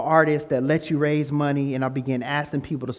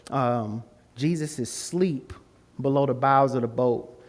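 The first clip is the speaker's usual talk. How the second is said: ordinary talk, nothing marked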